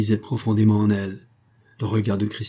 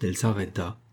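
plain reading voice

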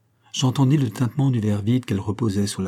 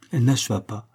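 ordinary delivery, nothing marked

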